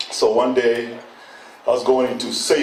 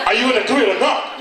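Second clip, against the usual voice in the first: impatient; 7 semitones higher, faster than usual. Retold or imitated. Impatient clerk.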